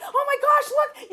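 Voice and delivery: high, loud alarmed cry; retelling a frantic plea to her husband; frantic call. Retold or imitated. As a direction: call for help in a panic. Hair burning, calls husband.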